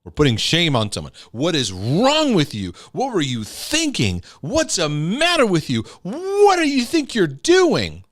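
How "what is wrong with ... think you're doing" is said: scolding parent. Retold or imitated. Parent scolding child, retold.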